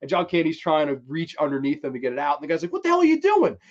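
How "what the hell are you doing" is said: high, loud startled demand; mock outrage. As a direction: imitate an affronted man yelling. Outraged man on beach, acted.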